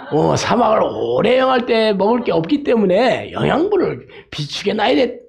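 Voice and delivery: put-on singsong mum explaining to a child; mother camel. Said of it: "낙타 새끼가 엄마한테".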